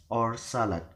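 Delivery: "or salad" with a downward tune; falling intonation. Shown as a demonstration. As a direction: let "salad" fall to close. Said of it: "then falling".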